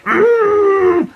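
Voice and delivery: very high indignant fairy "mm"; a squeaky offended hum; Reginald's fed-up little noise; huffy hum. Acted. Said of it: "Reginald made a sound like".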